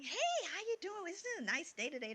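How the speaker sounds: high, sing-out cheery hello; chatty friendly greeting. Retold or imitated. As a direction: imitate a chatty checker greeting a customer. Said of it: "They're just chatting up".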